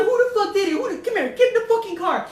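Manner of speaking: high, loud mock scolding; furious Mexican mom. Mom demands son's attackers, furious Mexican accent. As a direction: imitate an irate Mexican mother giving orders. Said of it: "that's how I impersonate her".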